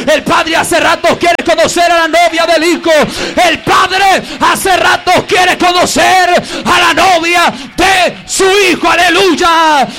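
loud, slow fervent preaching; fiery preaching. Real, not acted. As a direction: proclaim it with full fire. Preaching at full force. (predicación a toda voz)

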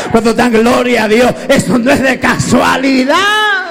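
loud call for praise; preaching shout. Acted. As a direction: rouse the congregation. Preaching climax. (predicación a gritos)

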